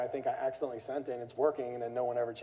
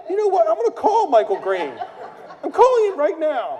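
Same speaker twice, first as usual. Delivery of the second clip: much higher, much louder laughing rant; mock furious threat; 13 dB louder than usual. Homeowner vowing to call Michael Green, laughing mock rage. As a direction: storm off to the phone, hammed-up.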